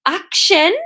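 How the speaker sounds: loud, high incredulous boom; booming outrage. A narrator's reading. Llewellyn pouncing on Hamish's choice of word, booming. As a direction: boom it back as an affronted question. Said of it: "boomed Llewellyn".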